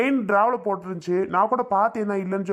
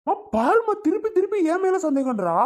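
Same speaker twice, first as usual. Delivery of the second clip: whining complaint; 11 semitones higher than usual. Acted. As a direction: cry out to mom that she keeps blaming you. Son complains to mother, accused. (அம்மாவிடம் அநியாயம் என்று உரக்க முறையிடுதல்)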